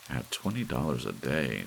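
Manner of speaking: ordinary delivery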